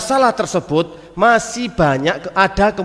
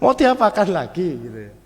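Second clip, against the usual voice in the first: ordinary talk, nothing marked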